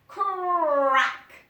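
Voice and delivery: loud, high sharp crack; loud sound effect. A narrator's reading. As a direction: snap it out like a stone hitting. Stone strikes the hawk.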